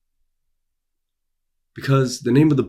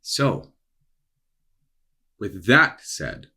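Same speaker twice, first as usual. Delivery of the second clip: normal talking voice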